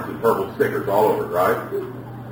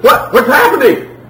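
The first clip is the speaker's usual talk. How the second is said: put-on high, loud, bewildered kid's cry; mock panic; 13 semitones higher, 8 dB louder than usual. Child turned over mom's knee, mock-panicked yelps. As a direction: blurt it out in hammed-up alarm.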